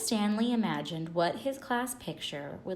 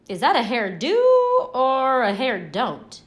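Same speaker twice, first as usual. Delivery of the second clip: loud, higher teasing quip; mocking joke; 5 semitones higher, 12 dB louder than usual. Larry mocking Stanley's hair before the class, loud teasing. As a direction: tease him in front of everyone.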